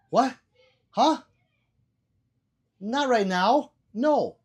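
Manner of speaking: incredulous retold shout; mock loud refusal. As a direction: act out the indignant refusal. Retold quarrel over the Coke.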